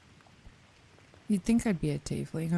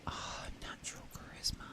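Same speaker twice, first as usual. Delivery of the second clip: seductive whisper; 13 dB quieter than usual. Pretend. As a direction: whisper it low and teasing. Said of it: "say things in, like, a seductive voice".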